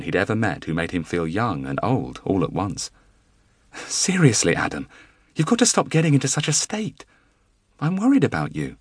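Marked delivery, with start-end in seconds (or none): 3.7-8.8 higher, earnest pleading wife voice; voiced worried wife. A narrator's reading. Wife telling husband she is worried about him, higher earnest voice. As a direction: press him with loving worry.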